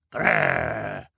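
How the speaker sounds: low, loud animal-like growl. A narrator's reading. Sunny in disguise agreeing with Violet, low loud growl. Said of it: "Sunny growled, in disguised agreement".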